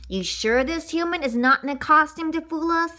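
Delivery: ordinary delivery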